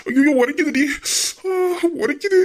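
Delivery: high wail at the burning; hammed-up pain, rising; pained yelping. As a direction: cry out as if the chilli scorches. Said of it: "சரியா, கத்தாதயா".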